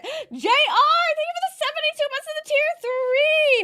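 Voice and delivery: gasping, high, loud thanks; excited. Real, not acted. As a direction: burst out with excitement.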